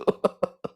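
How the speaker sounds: voiceless, trailing chuckle; real laugh. Real, not acted. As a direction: let the laugh run out of voice. Laughs at own story.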